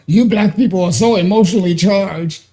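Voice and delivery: lightly mocked quote of a troll; a hint of sneering mimicry; mocking troll quote. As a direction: parrot the troll's line with light scorn. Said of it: "We think about".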